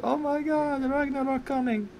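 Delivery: high put-on voice, a touch soft; playing Scarlet Witch having a vision; mock Wanda. Friends joke about Wanda seeing Ragnarok, high mock voice.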